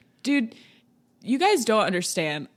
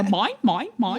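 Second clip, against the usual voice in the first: Australian pelican voice; hint of an Australian pelican; pelican in Finding Nemo; 3 semitones higher, 4 dB louder than usual. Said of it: "sound like the pelican in Finding Nemo".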